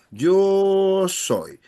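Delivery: "yo soy" drawn out very slowly; slow modelling. Shown as a demonstration. Teacher slowly modelling yo soy, very drawn out. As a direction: stretch "yo soy" for the class. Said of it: "So this is our aim".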